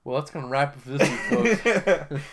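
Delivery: host voice, wrapping up the show; mock host sign-off.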